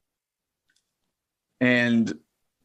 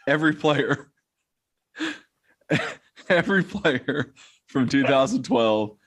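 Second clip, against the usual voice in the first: laughing; 3 semitones higher than usual. Real, not acted. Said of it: "we'll start dying laughing".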